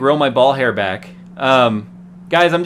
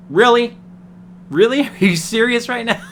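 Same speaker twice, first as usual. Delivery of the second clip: much higher, incredulous questioning; retold disbelief; 9 semitones higher than usual. Reacting to a friend's flashy rental car, incredulous. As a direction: replay an appalled double take, pitched high. Acted reaction to yellow Corvette.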